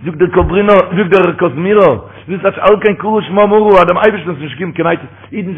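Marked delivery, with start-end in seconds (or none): none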